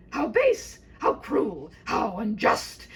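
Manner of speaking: hammed-up knightly outrage; mock outrage. A narrator's reading. Narrator voicing the knight's outrage, loud and hammed-up.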